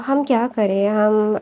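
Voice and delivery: ordinary delivery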